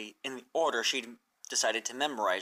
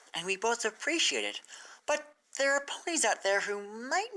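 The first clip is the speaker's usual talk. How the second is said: higher, lightly voiced friendly mare; Twilight's voice, a touch higher and kind; 7 semitones higher than usual. A narrator's reading. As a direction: gently nudge a friend, a bit higher.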